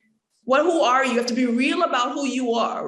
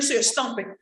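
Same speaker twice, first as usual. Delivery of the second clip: usual speaking voice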